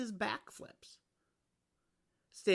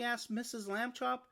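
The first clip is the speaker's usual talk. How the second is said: ordinary delivery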